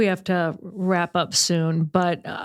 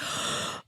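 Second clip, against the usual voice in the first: soft breathy intake of surprise; mock gasp; 11 dB quieter than usual. Friend replaying her reaction to his plan, mock gasp. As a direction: gasp softly as if overcome.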